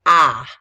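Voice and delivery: loud, crisp "ah" on its own; isolated vowel sound. Shown as a demonstration. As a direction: sound out "ah" loudly for spelling. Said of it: "the sound is".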